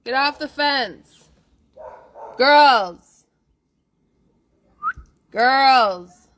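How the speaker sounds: shouting across the yard; yelling at dogs. Real, not acted. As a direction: call the dogs off the fence loudly. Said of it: "I'm gonna go yell at the dogs".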